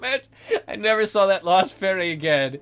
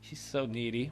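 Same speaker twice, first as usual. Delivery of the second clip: much softer, slowed muttering aside; muttered aside; 7 semitones lower, 11 dB quieter than usual. Real, not acted. Streamer mutters to viewers about the needy fairy, hushed aside. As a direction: grumble it under your breath to the audience. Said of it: "aside to viewers".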